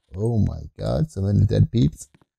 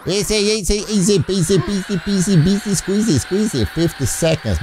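ordinary talk, nothing marked

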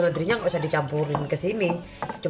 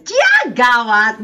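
drawn-out catchphrase; 6 semitones higher, 8 dB louder, much slower than usual. Acted.

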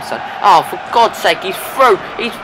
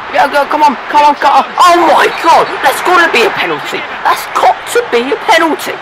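excited shouting; 9 semitones higher, 4 dB louder than usual. Real, not acted. Urging team, claiming penalty.

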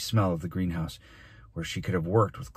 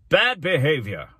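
loud, high, drawn-out scolding; outraged cry; 9 semitones higher, 6 dB louder than usual. A narrator's reading. Mayor rebuking the boy before the class, outraged cry. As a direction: cry out in pompous outrage, slowly. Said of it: "cried the mayor".

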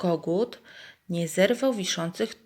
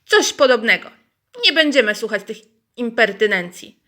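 louder and higher, huffy; indignant hens, louder; offended hens; 4 semitones higher, 8 dB louder than usual. Acted. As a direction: storm off insulted. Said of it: "I obrażone odeszły".

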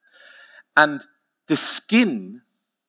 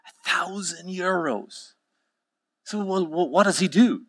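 stunned, hushed and high-pitched; incredulous customer; 7 semitones higher, 5 dB quieter than usual. Acted. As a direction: gasp the price back in a small high voice. Joke customer reacting to the price.

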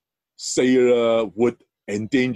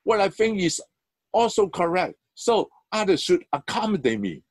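arrogant; 9 semitones higher than usual. Retold or imitated. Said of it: "they will say".

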